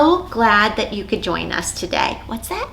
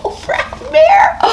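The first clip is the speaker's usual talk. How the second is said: excited; 5 dB louder than usual. Acted. Puppet reveal.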